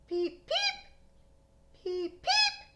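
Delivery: tiny squeaky peeps, very high; high frog peeps. Acted. Storyteller voicing the frogs, high peeping. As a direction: chirp like little spring peepers.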